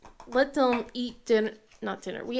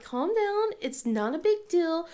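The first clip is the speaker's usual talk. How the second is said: lightly raised, calming tone; mom soothing; 6 semitones higher than usual. Retold or imitated. Mom calming her daughter, a touch higher. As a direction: talk her down gently. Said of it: "and I was just like".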